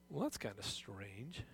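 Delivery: soft, low, slow puzzled aside; puzzled listener. Retold or imitated. Pastor voicing a doubting listener, quiet and puzzled. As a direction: muse to yourself that it sounds odd. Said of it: "You think".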